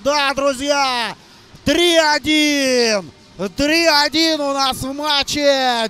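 loud, drawn-out goal shout; excited hockey goal call. Real, not acted. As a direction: roar it out as the puck goes in. Goal scored, commentator erupts.

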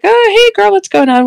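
put-on high-pitched casual girlfriend hello; retold chirpy hello; breezy greeting. Retells breezy greeting to sister.

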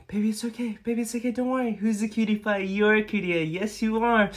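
high, cutesy cooing; soothing baby talk. Acted. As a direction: comfort your sweetheart in a baby voice.

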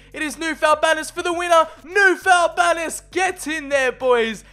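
celebrating a go-ahead goal, loud and high; jubilant goal call. Real, not acted. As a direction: roar the scorer's name like a commentator. Scoring the winner.

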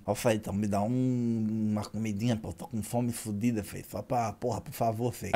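soft, pleading street beggar begging for food; imitating a beggar. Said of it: "Aí ele falou assim".

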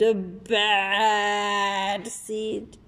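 very slow, dragged-out growl of the name; menacing drawl. Acted. Seed relishes his own name, very slow. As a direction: savour each word like a threat.

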